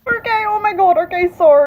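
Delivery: high, loud squealing outburst, half crying; can't contain the excitement, voice cracking up high; overexcited fangirl shriek with a sob in it; excited squeal. Real, not acted. Overwhelmed by book news.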